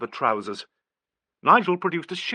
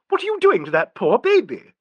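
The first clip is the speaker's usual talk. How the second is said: high, loud indignant cry; 12 semitones higher, 7 dB louder than usual. A narrator's reading. Angela scolding the men, indignant cry. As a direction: scold them in outrage. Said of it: "cried an indignant voice".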